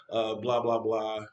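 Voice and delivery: normal talking voice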